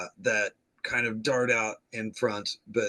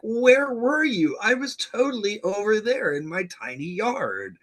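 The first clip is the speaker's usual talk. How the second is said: high mock Danny; 10 semitones higher, 4 dB louder than usual. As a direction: play dumb in a high voice. Danny voiced in high pitch.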